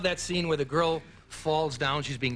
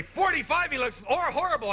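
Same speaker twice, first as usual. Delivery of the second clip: lightly voiced gasp at his age, higher; mock shocked viewer; 7 semitones higher than usual. Comic imitating fans reacting to his age, mild mock shock. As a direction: play a nosy fan aghast at his looks. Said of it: "the other half is gonna say".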